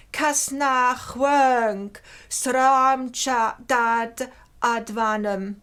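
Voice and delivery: slow, measured chant of the Avestan verse; Avestan recitation. Shown as a demonstration. Lecturer reads the Avestan stanza aloud to students, slow solemn recitation. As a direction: intone each Old Avestan word slowly and carefully. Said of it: "you allow me to read it".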